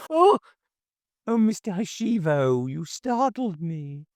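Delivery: high, flustered surprise; startled gasp. A narrator's reading. Neighbour startled as Hashiva suddenly reappears, flustered and high. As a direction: caught off guard, gasping out. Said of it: "let out a startled gasp".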